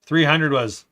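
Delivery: usual speaking voice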